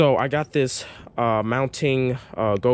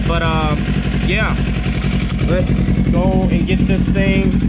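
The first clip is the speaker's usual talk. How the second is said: raised voice inside a helmet; talking loud through a helmet mic; 6 semitones higher, 6 dB louder than usual. Real, not acted. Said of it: "I hope you can hear me".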